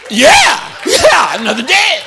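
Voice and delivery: high, loud sarcastic yeah yeah; mock the dreary morning with a shout; sarcastic mock cheer.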